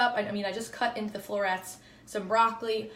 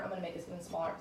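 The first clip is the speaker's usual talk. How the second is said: ordinary delivery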